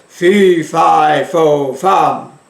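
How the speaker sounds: loud booming giant chant, each word punched; giant voice from the belly. Shown as a demonstration. Teacher demos a giant for the class, loud and booming. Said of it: "from his belly and it would sound like this".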